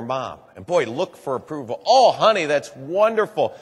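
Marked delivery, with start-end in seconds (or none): 1.9-3.5 higher, louder delighted praise; mother praising her daughter, delighted. Retold or imitated. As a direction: cheer her on like a proud mom.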